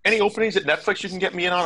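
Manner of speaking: high, fast pleading voice; bright, pushy asking; eager job seeker. Retold or imitated.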